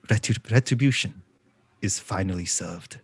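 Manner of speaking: understated, low, quiet pronouncement; Jean's grim line. Acted. Player voices Jean watching the bank burn, grave and quiet. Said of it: "the only thing he really says is".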